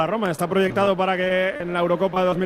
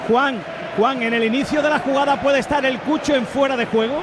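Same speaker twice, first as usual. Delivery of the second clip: urgent raised voice; 6 semitones higher than usual. Real, not acted. Urgent interjection.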